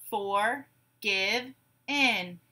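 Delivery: "Forgive in" as three slow, separate beats; syllable by syllable. Shown as a demonstration. Teacher gives syllables to blend, "Forgive in" broken apart. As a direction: say "Forgive in" as for, give, in, pausing between. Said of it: "I will say syllables in a word".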